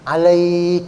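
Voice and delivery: "Alaihi" with the lai held long; lengthened ending. Shown as a demonstration. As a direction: hold the "ai" in "Alaihi" on a level note. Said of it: "boleh dipanjangin".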